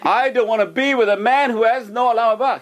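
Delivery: high, indignant mock protest; hammed-up outraged wife; indignant refusal. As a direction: imitate an appalled wife, half amused. Wife rejects merchant, teller laughing.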